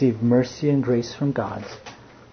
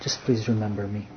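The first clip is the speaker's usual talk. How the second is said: much softer, pleading ask; quiet plea; 3 semitones lower, 8 dB quieter, faster than usual. Acted. The dying thief begging Jesus, soft and pleading. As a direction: beg it quietly, a last hope. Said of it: "last hope he had".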